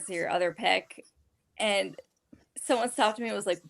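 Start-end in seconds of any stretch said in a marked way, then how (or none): none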